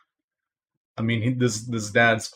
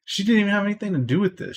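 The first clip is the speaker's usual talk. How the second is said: higher, fast indignant protest; indignant outburst; 10 semitones higher, 4 dB louder, much faster than usual. Real, not acted. Outraged cohost defending the mother, fast and high. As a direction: rush it out in disbelief.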